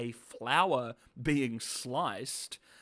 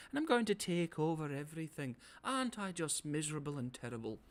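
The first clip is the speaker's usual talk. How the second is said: higher, softer, simpering evil villain; hammed-up villain monologue; 4 semitones higher, 5 dB quieter than usual. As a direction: purr it like a cartoon baddie gloating. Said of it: "just, like, monologuing".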